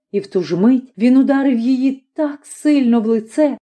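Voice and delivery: usual speaking voice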